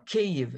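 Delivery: Kyiv drawn out slowly in two syllables; Kyiv, KEE-yiv, spoken slow and clear; slow careful Kyiv. Shown as a demonstration. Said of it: "it's quite easy".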